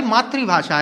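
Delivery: "मातृभाषा" as maatri-bhaashaa; corrected pronunciation. Shown as a demonstration. Teacher giving pupils the right form, "मातृभाषा" as maatri-bhasha. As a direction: say maatri-bhasha for "मातृभाषा", tri not tra. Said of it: "लेकिन मातृ नहीं है".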